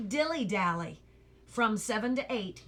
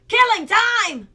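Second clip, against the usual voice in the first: much higher, very loud furious roar; 11 semitones higher, 14 dB louder than usual. A narrator's reading. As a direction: explode with outrage. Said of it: "roared the dog".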